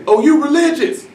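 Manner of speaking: high mock voice, dismissive; high, scoffing mock; mocking bystander. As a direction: sneer like the average person would. Average person mocks him.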